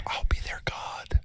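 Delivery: slow, breathy villain whisper; slow menacing whisper; creepy whisper. Acted. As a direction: whisper it like a god of death. Creepy killer quote in a bit.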